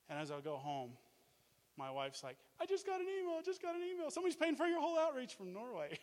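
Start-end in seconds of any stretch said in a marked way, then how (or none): 2.6-6.0 high-pitched, breaking-news excitement; excited wife. Retold or imitated. Husband imitating his wife announcing the sponsor email, high excited voice.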